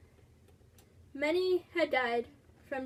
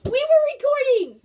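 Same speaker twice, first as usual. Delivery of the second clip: alarmed shout; 12 semitones higher, 15 dB louder than usual. Real, not acted. Realizes parent interrupted the recording.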